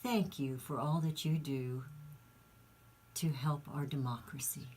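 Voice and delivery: soft, hushed thank-you with long pauses; softly quoted politician. Retold or imitated. Nancy thanking her interviewer after the interview, softly imitated. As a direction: imitate heartfelt gratitude, sinking almost to a whisper. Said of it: "Nancy told him".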